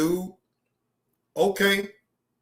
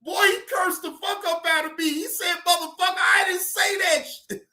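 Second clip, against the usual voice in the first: high-pitched, tickled retelling; amused exclaiming; 10 semitones higher than usual. Real, not acted. Streamer retells being cursed out, amused exclaiming. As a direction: marvel at it, voice way up high. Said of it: "I was able to laugh".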